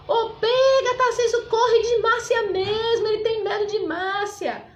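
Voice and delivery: high, loud mocking call up the hill; laughing taunt. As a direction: imitate a friend jeering after a runaway. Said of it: "começou a rir e falou".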